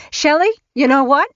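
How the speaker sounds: finally snapping at her daughter; sharp new tone. A narrator's reading. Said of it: "She used a tone she'd never used before".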